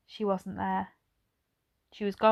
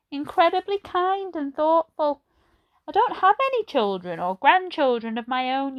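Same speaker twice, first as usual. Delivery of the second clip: put-on old lady voice; 7 semitones higher, 4 dB louder than usual. A narrator's reading. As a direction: voice her like a gentle elderly lady. Edna remembers Granny.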